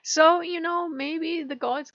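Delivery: high, light delighted remark; a bright little wow; pleasantly surprised. Retold or imitated. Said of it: "I'm like".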